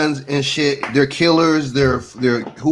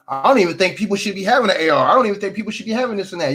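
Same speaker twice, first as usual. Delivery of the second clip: quick, higher, mocking voice of a timid grown man; mocking whiny grown man; 7 semitones higher, faster than usual. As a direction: rattle it off in a high, mocking whine. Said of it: "grown ass men talking like that".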